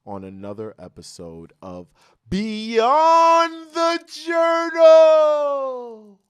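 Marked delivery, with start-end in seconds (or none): 2.3-6.2 long, high, drawn-out show-title shout; announcer shout. Acted.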